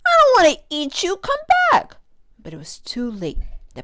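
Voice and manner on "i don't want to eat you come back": high, loud desperate call; pleading shout. Acted. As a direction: beg them to come back. Begging fleeing fish to return.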